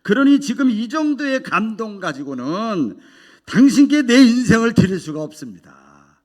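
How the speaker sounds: a preacher arguing with God, loud and stubborn; defiant protest. Retold or imitated. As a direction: push back hard, word by word. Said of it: "하나님께 따지는 거예요".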